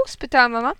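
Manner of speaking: usual speaking voice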